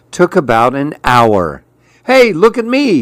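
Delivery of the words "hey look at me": high, eager lizard call; calling for attention. Acted. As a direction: shout to be noticed. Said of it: "he said".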